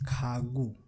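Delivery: Khagu with G in the middle; Korean word Khagu. Shown as a demonstration. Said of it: "it is pronounced as G".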